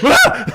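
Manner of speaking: high shrieking yelp of surprise; hammed-up startled cry; panicked yelp. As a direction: yelp like a villain caught off guard. Mock panicked yelp of villain.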